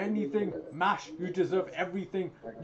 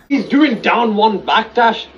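loud furious ranting; rage-yelling at the game; raging gamer; 15 dB louder than usual. Real, not acted.

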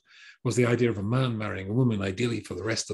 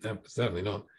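ordinary delivery

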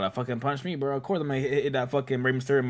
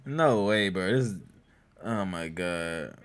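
usual speaking voice

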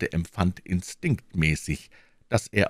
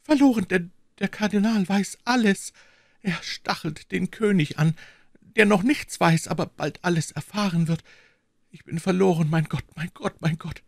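high, anguished lament; mild sobbing despair fading out; despairing queen; 13 semitones higher than usual. Acted. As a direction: she sees she is lost.

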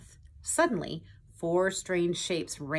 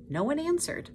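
plain reading voice

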